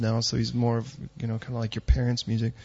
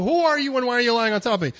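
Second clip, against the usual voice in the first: high, fast bewildered question; the boy waking under a stranger, confused; 10 semitones higher, 5 dB louder, faster than usual. Retold or imitated. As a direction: wake up baffled. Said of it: "the boy to wake up and go".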